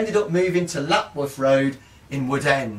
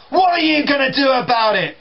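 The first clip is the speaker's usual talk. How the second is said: higher, louder challenge; defiant kid; 10 semitones higher, 5 dB louder than usual. Retold or imitated. Younger brother challenging his brother, higher and louder. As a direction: square up to him. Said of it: "Robert points finger".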